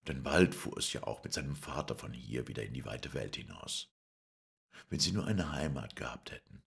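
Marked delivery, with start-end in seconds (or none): none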